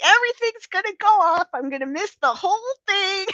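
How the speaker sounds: high-pitched playful fretting; mock distress. Woman joking she will miss everything, mock distressed. Imagines herself fretting at missing out.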